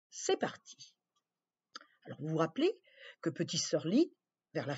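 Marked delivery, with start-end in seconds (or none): 0.1-0.9 whispered go. Real, not acted. Enfant chuchote le départ.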